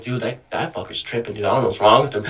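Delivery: low, hushed aside to a friend; retold confidential aside. Pulls friend aside about the one tripping, low confidential aside. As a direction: keep it low, just between the two of you.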